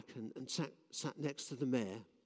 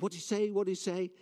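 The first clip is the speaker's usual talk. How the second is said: higher, louder crowd calling out; 7 semitones higher, 5 dB louder, faster than usual. Retold or imitated. Crowd at the back calls out, straining. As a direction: shout from the back, straining to hear. Crowd can't hear John.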